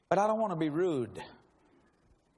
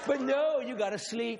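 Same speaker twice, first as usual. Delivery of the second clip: exasperated shout; 7 semitones higher, slower than usual. Retold or imitated. Scolding wife who slept through it.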